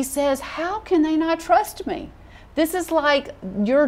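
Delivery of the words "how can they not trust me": higher-pitched wounded question; mildly acted hurt. Preacher voices God taking distrust personally, mildly hurt. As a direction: lightly voice a hurt, disbelieving plea. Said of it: "and he just is hurt".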